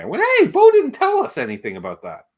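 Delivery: ordinary talk, nothing marked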